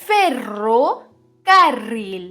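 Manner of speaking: usual speaking voice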